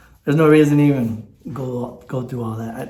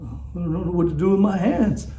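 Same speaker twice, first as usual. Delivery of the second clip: lightly voiced Ricky Bobby, a bit higher; a hint of the awkward race-car driver; Ricky Bobby quote; 4 semitones higher than usual. Talks about not handling praise, quotes Ricky Bobby lightly. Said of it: "Ricky Bobby".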